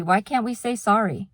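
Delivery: ordinary talk, nothing marked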